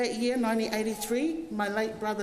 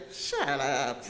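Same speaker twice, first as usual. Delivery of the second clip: grumpy retort; 8 semitones lower than usual. Retold or imitated. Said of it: "he would turn around and go".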